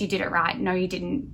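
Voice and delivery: ordinary talk, nothing marked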